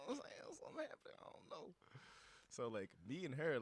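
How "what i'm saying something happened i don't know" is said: high, hushed, half-whispered aside; a light mock whisper to a friend; flustered whisper. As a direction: murmur it to a friend, baffled.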